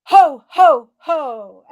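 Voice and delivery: loud, forceful ho ho ho; forceful ho laugh. Shown as a demonstration. Coach leading the group in forceful ho ho ho. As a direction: throw the ho ho out from the belly. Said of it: "And the third time".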